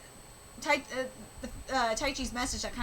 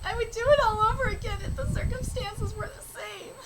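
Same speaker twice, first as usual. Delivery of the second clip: higher, earnest reading voice for the characters; reading manga aloud; 9 semitones higher than usual. Acted. Vlogger reading karuta manga dialogue to viewers, earnest and higher. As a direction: read each speech bubble with feeling.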